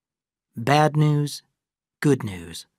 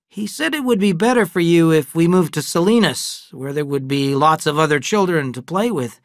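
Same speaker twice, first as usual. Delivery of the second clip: a lightly voiced father, a bit raised; father gently breaking news, raised; Adam; 5 semitones higher, 6 dB louder than usual. Father telling his twin sons about moving, lightly voiced.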